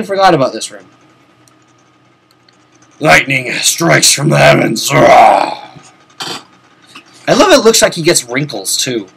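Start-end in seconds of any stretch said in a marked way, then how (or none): 4.9-5.6 short hammed-up war cry; louder growled rah; mock battle roar. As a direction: roar as the hero powers up. Lightning strikes the hero.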